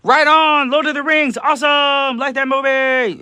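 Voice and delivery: high, loud gushing fan; excited listener voice. Retold or imitated. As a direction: read it like a thrilled fan. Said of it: "She says".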